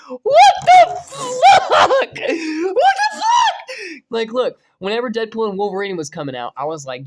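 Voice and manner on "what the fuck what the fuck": slow, high, laughing shouts; laughing disbelief. Real, not acted. A fan seeing Nightcrawler return, laughing and shouting. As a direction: shout it out through laughter, overwhelmed.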